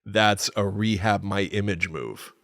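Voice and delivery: normal talking voice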